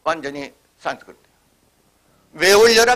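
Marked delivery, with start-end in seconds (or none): 2.3-3.0 call out. Retold or imitated. Quoting a dialect call.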